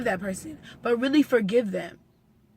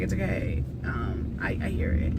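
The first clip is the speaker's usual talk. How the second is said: gentle mom; 3 semitones lower, 5 dB quieter than usual. Retold or imitated. Mom comforting her crying daughter.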